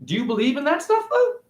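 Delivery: high, mocking incredulous voice; mock their belief with high disbelief; high skeptical taunt; sarcastic question. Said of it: "I'm like".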